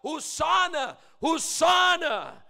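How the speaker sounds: high, loud Hosanna, the second drawn out; crowd shout. Retold or imitated. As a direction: cry out like a desperate crowd. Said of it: "sumisigaw po sila".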